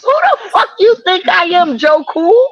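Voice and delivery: rising indignant shout; angry. Real, not acted. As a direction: fire back, outraged. Heated argument about being timed out.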